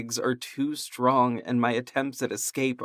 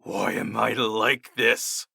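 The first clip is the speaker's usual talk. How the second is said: slow, garbled monster voice; garbled beast voice; slower than usual. Acted. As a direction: growl it out like a beast. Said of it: "I garbled out in my unusual voice".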